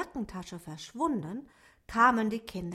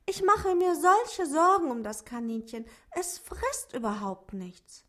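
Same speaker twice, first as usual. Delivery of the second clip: put-on higher girl's voice, worried; 7 semitones higher, 5 dB louder than usual. A narrator's reading. Ute tells Simon she worries about the rabbit, a higher voice. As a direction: voice the girl fretting over her rabbit. Girl character voice.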